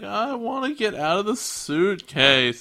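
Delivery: high, drawn-out pleading whine; imitated childish complaint; mock whine. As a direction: whine slowly like a stuck creature. Gilmon complains in hot suitcase.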